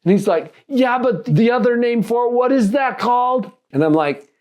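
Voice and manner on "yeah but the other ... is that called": high-pitched imitated teenager; imitated eager kid, pitched up; eager teen. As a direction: play a teen fishing for the word. Acting out teen pressing for word.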